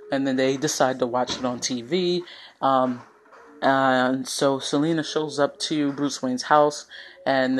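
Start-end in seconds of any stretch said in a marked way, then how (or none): none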